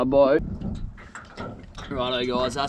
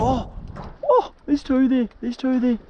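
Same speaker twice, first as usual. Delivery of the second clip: excited; 11 semitones higher than usual. Real, not acted. Excited at two squid.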